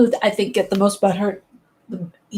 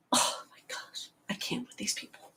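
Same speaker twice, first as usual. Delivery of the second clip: hushed, breath-only mock despair; exasperated whisper; 17 dB quieter than usual. Zelda fan giving up on these people, exasperated whisper. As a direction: whisper it as if fed up. Exasperated end of the acted rant.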